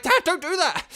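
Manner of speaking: high, loud retold yell at dad; mock-scolding yell; retold alarmed protest. Retelling protest at dad tapping mic.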